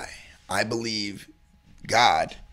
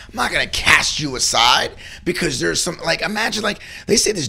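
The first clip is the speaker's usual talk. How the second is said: ordinary talk, nothing marked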